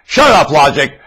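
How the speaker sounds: loud shouted command; mock yell. Preacher voicing sellers yelling at logic, a loud shout. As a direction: yell it like they do. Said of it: "That's what they're yelling".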